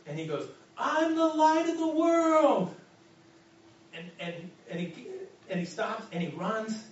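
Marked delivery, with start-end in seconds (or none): 0.8-2.7 high, loud, slow ecstatic shout; shouting it much higher and louder into the night; joyful yell. Retold or imitated. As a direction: cry it out to the whole street. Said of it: "he keeps yelling".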